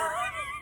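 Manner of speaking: sudden high giggle; real laugh bursting out; high burst of laughter. Real, not acted. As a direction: break into a squealing laugh. Laugh after husband quote.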